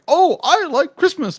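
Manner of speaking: very high, squeaky British accent attempt. Co-host mimics naive Arthur in a squeaky British accent. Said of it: "hard to really pull that accent".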